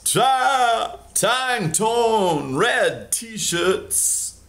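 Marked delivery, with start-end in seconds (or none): none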